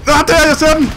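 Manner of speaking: high, loud shout of discovery; real gaming excitement; excited shout. Real, not acted. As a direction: yell that you've spotted it. Spotting a shark in game.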